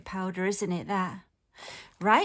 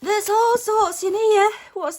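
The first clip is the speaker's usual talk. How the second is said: excited; 10 semitones higher, 6 dB louder than usual. Real, not acted. Opening a gift box excitedly.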